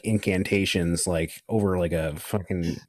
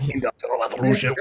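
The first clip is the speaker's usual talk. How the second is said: higher put-on villain voice; Klaus; 5 semitones higher, faster than usual. Guest reprising Klaus for laughs with co-host, villainous impression. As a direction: bring back the mock super-villain voice. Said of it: "With the voice".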